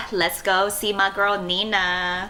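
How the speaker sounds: normal talking voice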